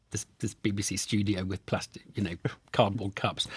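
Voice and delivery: whispered aside; whispering. Real, not acted. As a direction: whisper it like a secret. Aside about studio cups.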